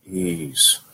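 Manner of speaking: soft, high, drawn-out ease; soothing self-talk. Acted. Bowler calming themselves at the line, soft self-talk. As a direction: float it out gently, high and light. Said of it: "said to yourself".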